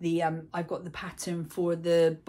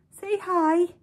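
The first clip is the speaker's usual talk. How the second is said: high, louder cutesy voice; pet voice; 12 semitones higher, 9 dB louder than usual. Real, not acted. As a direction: coax the cat to greet the camera. Getting the cat to greet viewers.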